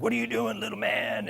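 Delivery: put-on drunk editor. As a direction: play the drunk editor looming over the kid. Drunk editor voiced in story.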